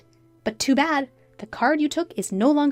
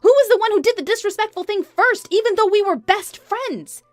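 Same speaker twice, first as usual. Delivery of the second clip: high, raised, accusing questions; indignant wife; 11 semitones higher, 4 dB louder than usual. Acted. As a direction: turn the blame back on her. Wife turns accusation back.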